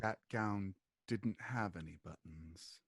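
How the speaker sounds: low, very quiet denial; cool lady. Acted. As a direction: answer softly, coolly dismissive. Lady coolly answers the questioner.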